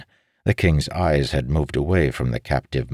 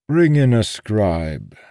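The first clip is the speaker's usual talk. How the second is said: higher, slow, measured request; pitched-up, unhurried old man; old schemer instructing; 7 semitones higher, 4 dB louder, slower than usual. Acted. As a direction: give a slow instruction in a raised character voice. Voiced captive instructs the king.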